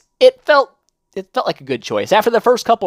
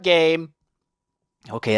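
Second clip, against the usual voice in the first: ordinary talk, nothing marked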